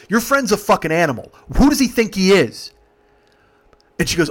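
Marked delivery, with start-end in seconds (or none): 0.1-2.7 indignant, raised and higher voice; loud re-enacted complaint; retold angry rant. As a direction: vent outrage at the friends. Driver complains angrily to girls.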